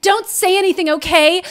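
usual speaking voice

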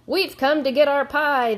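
loud, high group proclamation; army demand. A narrator's reading. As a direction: announce it like a marching army. Gator army announces its demand.

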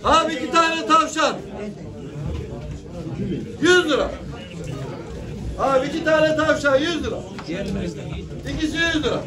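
auctioneer calling out prices. Real, not acted. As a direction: chant the bids to the crowd. Calls bids at animal auction.

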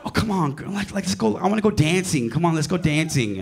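high mock pleading; pleading girlfriend. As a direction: imitate a young date begging to go out. Younger date begs to dance.

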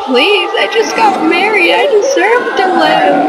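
high-pitched begging voice; high, dramatic mock plea; mock pleading. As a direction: plead for your life, hammed up. Voices condemned man begging for life.

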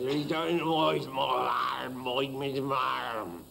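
slow, lightly voiced Sussex countryman; rustic Sussex accent. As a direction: drawl a hint of a Sussex yokel. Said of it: "a very rustic voice".

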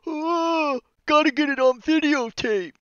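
high, airy mocking voice; mocking bystander. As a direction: sneer it like a vain onlooker. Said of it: "they're just sitting there".

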